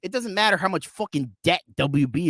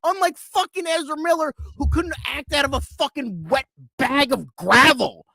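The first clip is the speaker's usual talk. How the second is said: furious rant; 7 semitones higher than usual. Real, not acted. Angry rant about WB.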